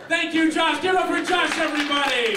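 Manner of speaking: high, loud showman reveal; kids party magician. Acted. Magic trick reveal and applause.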